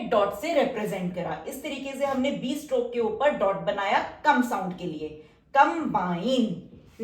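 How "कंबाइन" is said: drawn-out word. Shown as a demonstration. Teacher stretching combine slowly while writing the outline. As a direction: draw out combine syllable by syllable as you write.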